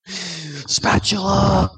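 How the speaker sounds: drawn-out spatula. Pretend. Shouting the weapon name.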